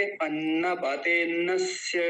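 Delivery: अन्नपते नस्य slowly joined, no अ before नस्य; correct pronunciation, अ dropped. Shown as a demonstration. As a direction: stretch अन्नपते and glide straight into नस्य. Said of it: "कैसे बोलेंगे?". (अ हटाकर अन्नपते नस्य का धीमा उच्चारण)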